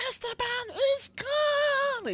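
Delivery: very high, slow shrieked line; Coco Petal yelling. Retold or imitated. Reviewer imitating Coco Petal yelling that Esteban is gone. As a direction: scream it in his face. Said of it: "he just goes".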